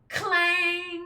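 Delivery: loud ringing clang like a giant bell. Acted. Voicing the golden bell for the listeners, a ringing clang. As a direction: belt out the bell's clang.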